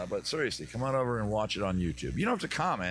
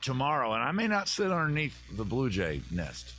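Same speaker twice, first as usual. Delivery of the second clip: normal talking voice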